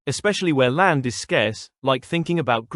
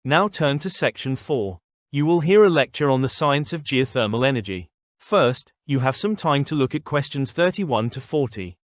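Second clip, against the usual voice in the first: lecturing. Acted. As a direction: clear formal test announcer. Reads exam instructions.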